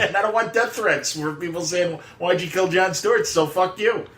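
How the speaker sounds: high, hammed-up refusal; mock writer refusing. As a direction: refuse them in a high mocking voice. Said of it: "Josh Fialkoff going".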